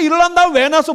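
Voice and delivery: high-pitched quoted complaint; mimicked accusing child. Child asks mother why born, mock accusing. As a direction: imitate a child blaming mom. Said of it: "묻는 거 아니야".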